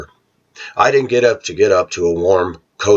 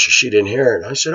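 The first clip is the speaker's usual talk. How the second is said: ordinary delivery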